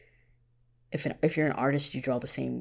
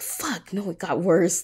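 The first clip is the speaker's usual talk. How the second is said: dismayed outburst; 4 semitones higher, 4 dB louder, slower than usual. Retold or imitated. Reacting to plot turning worse.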